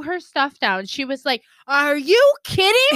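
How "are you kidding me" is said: high, loud indignant retort; fired-up retort. Retold or imitated. Retelling how Carissa snapped back on air, indignant. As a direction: imitate a host snapping back, higher and louder. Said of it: "you could see she was fired up".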